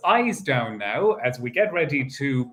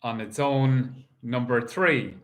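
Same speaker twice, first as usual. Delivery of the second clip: steady sing-song number calling; bingo caller. Acted. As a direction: call each number out to the hall. Calls the bingo numbers live.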